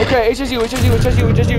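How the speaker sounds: ordinary talk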